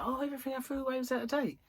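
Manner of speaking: mocking Gary. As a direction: parrot his lame excuse quickly. Mocking Gary's excuse.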